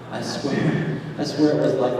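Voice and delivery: ordinary talk, nothing marked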